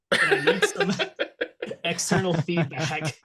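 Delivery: laughing through the words; breaking up with laughter mid-sentence. Real, not acted. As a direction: giggle out the request. Laughing while asking.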